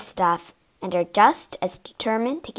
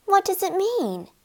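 put-on high, curious child's voice; puzzled girl; 10 semitones higher, 3 dB quieter than usual. Puzzled girl reading a poem.

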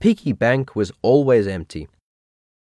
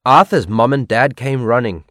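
usual speaking voice

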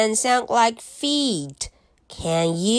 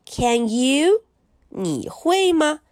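plain reading voice

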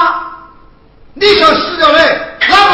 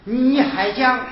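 ordinary delivery

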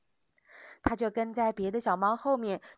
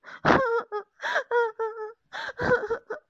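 high, loud bawling sob; crying wail; 11 semitones higher, 6 dB louder than usual. Acted. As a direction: wail like a hurt kitten. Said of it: "咪咪越哭越伤心".

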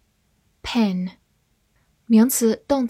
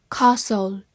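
British "Castle" with a long ah; British accent. Shown as a demonstration. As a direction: say "Castle" the British way, KAH-sul. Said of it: "美式发音".

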